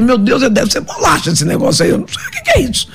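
high squeaky bewilderment; mock baffled outburst; comic confused squeal; bewildered. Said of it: "Eu falei".

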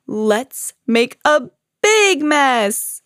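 very slow, drawn-out cry swelling on "big"; big, gleeful storybook bear cry; exuberant cry. A narrator's reading. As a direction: stretch every word, punch "big mess" high. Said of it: "cried Bear".

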